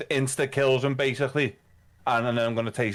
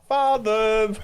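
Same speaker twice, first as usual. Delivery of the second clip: high, loud sing-out; triumphant raised-voice announcement; calling out; 10 semitones higher, 5 dB louder than usual. Acted. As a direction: call it across the room to a companion. Player calls out finding the keys.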